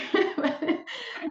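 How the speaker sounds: a real, high burst of laughter after saying sorry; embarrassed laugh. Real, not acted. Apologising to her dad on air, then a high laugh. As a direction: laugh it off, a bit sheepish.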